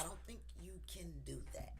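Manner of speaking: hushed unvoiced whisper. Real, not acted. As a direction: lean in and whisper it. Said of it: "whispered aside".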